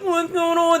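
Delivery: high, garbled confused question; numb-tongued. Acted. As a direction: slur it through a numb tongue. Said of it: "your tongue is numb".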